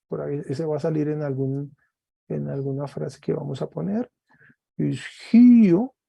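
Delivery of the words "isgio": Isgio high and loud, rising; emphatic model. Shown as a demonstration. Teacher repeats Greek verb emphatically to students. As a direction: punch out Isgio for the class. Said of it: "espíritu suave y agudo".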